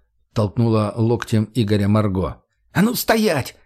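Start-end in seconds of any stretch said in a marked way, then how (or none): 2.7-3.6 high nervous yelp; nervous squeal. Acted. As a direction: squeal it out in fright. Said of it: "нервно взвизгнул мужик". (нервно взвизгнуть)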